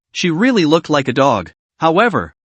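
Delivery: plain reading voice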